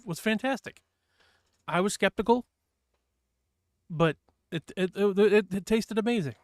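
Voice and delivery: excited. Real, not acted. Enthusing about the pasta.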